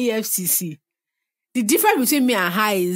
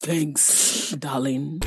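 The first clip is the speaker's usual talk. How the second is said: smooth charmer; 5 semitones lower, 4 dB quieter, much slower than usual. Pretend. Voicing suitor, low and soft.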